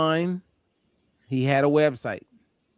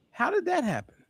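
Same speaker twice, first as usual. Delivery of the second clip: curious question; 12 semitones higher, 4 dB quieter than usual. Retold or imitated. Caller asking on phone.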